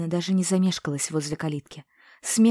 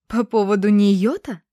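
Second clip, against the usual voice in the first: loud, scoffing and higher; scornful snort; 4 semitones higher, 10 dB louder than usual. A narrator's reading. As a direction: sneer at the very mention of her. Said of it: "фыркнула старостина жена".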